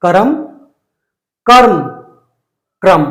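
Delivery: करम ka-ram, कर्म karm, क्रम kram, each clearly; three-way drill. Shown as a demonstration. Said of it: "फिर से देखिए".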